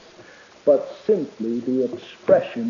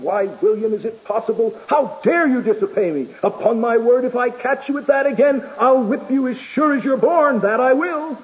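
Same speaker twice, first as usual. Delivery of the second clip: angry tone; 6 semitones higher than usual. Retold or imitated. Mother scolding disobedient William, a touch of hammed-up anger. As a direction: read out a mild imitation of a cross mother. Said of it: "in an angry tone of voice exclaim".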